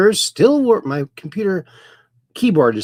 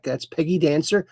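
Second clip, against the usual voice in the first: usual speaking voice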